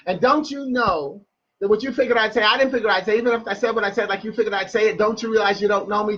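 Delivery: fast spoken-word run with no breaks; rapid-fire. Shown as a demonstration. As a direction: race through it like a tongue twister. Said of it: "I always say it that fast".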